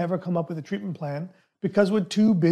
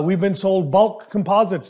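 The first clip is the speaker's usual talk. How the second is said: ordinary talk, nothing marked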